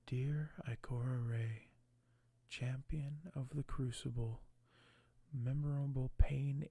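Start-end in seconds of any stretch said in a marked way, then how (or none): none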